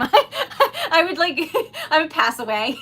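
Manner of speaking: laughing through the words. Real, not acted. Laughing at her own mixed-up phrase to viewers, laughing.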